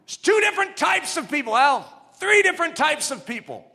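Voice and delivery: fiery pulpit shout; preaching at full force. Acted. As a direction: thunder it at the congregation. Preaching climax.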